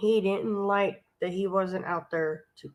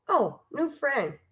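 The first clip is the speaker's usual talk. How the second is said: slow, swooping voice for a delighted dog; a hint of a dog greeting a new pal; lightly voiced friendly dog; 4 semitones higher than usual. Dog Lulu greeting the stranger in the yard, lightly voiced friendly dog.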